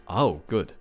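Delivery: ordinary delivery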